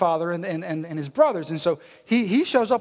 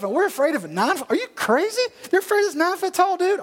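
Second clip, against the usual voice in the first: high, indignant mock disbelief; acted "are you serious" rant; incredulous; 10 semitones higher than usual. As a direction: voice leaps up in outraged questions. David incredulous at the folks.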